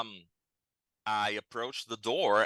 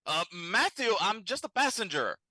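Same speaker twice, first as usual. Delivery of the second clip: high-pitched, hesitant plea through a door; high, anxious explanation; 12 semitones higher than usual. Acted. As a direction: explain yourself in a much higher voice. Stranger pleads through the door.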